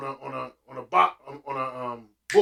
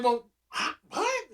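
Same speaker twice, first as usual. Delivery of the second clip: higher, puzzled huh-what; confused; 11 semitones higher, slower than usual. Retold or imitated. Son baffled by mom's plan, puzzled. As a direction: sound baffled. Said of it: "So I'm like".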